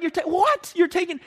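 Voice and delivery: very high mock disbelief; hammed-up squeaky astonishment; incredulous protest. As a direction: splutter in high-pitched shock. Repeats the owner's shocked protest.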